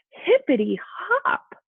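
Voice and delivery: high, loud and slow hippity hop; excited exclaim. Acted. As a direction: bounce the words up with joy. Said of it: "Hoppy exclaimed".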